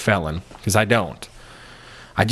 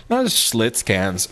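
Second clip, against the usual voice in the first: ordinary talk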